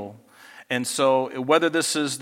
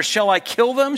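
quick, higher and louder, urgent; eager king; 8 semitones higher, 4 dB louder than usual. Acted. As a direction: blurt it out like a king itching to strike. First asking of the question.

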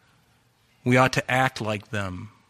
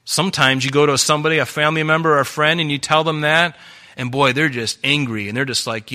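ordinary talk, nothing marked

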